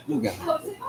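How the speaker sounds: laughing it out high; breaking up mid-line; real laughter. Real, not acted. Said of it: "speaker cracks up at own character".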